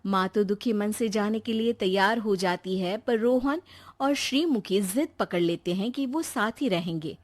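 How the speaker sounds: ordinary delivery, nothing marked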